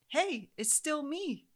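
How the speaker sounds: high, slow friendly greeting; ER doctor greeting the mother again, slow and friendly. Retold or imitated. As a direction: greet her like an old friend. Doctor greets her warmly.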